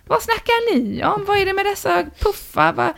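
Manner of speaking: high-pitched imitation of a wife; neighbour's wife. Puzzled wife questions the two men, imitated high voice. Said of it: "Hans fru".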